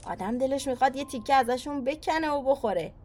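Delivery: cheerful dad. Acted. Father shows daughter cotton-candy trees, lively. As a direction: call her to look up, enthusiastic.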